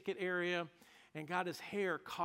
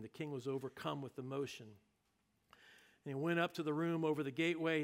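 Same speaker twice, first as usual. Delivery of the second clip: ordinary delivery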